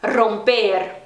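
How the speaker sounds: romper opening on a rolled "rr"; strong trilled r. Shown as a demonstration. Teacher showing learners Spanish romper, rolled r. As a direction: vibrate the first r of romper. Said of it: "bem forte".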